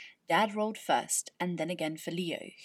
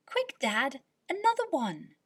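high, louder urgent request; eager plea; 7 semitones higher, 4 dB louder than usual. Acted. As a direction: hurry Dad along, thrilled. Leo eagerly asking for more.

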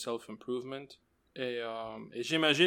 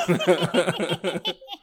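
witch cackle; 5 semitones higher, 7 dB louder than usual. Pretend. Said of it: "C'est mon rire de sorcière".

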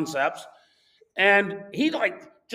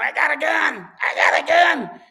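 loud, very high mock threat; imitated yelling; 9 semitones higher, 7 dB louder than usual. Old man walking up with pistol, imitated yelling. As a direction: mimic his yell, loud and pitched way up. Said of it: "starts yelling".

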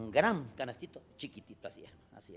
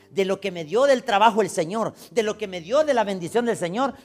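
loud, higher proclamation, slowing at the end; preaching; 7 semitones higher, 10 dB louder than usual. Acted. Pastor voicing a worshipper's offering, loud and raised.

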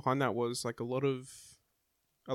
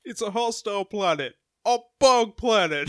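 movie quote voice; 8 semitones higher, 10 dB louder than usual. Retold or imitated. Quotes the film line.

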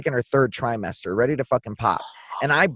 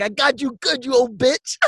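higher gloating tease; 8 semitones higher than usual. Retold or imitated. Son retells teasing his mom, mildly high gloating. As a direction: gloat over the prank. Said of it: "then I was like".

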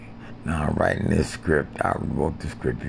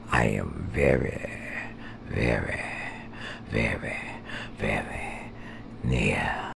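fading creepy whisper; 6 semitones lower, 6 dB quieter than usual. Pretend. Threatening he's very near, fading softer. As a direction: lower and softer word by word.

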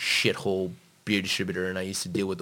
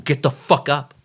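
louder, higher emphatic command; forceful wake-up order; 6 semitones higher, 8 dB louder than usual. Acted. Camp leader to someone who chose the camp, loud blunt command.

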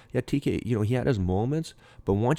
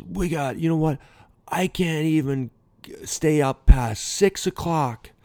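retold angry yelling; 8 semitones higher, 4 dB louder than usual. Manager blowing up at players over a late night, retold angry yelling. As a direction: imitate a fired-up manager's exasperated tirade. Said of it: "he started yelling at all the players".

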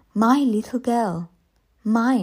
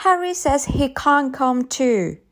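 lightly voiced child, higher pitch; little girl protesting; 7 semitones higher than usual. A narrator's reading. Child Chris protesting to mother.

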